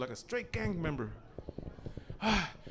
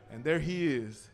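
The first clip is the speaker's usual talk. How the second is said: ordinary delivery, nothing marked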